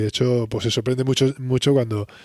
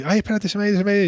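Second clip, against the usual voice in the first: flustered; 8 semitones higher, much faster than usual. Retold or imitated. Joey chasing the ball.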